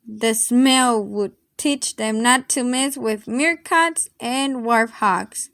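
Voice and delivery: plain reading voice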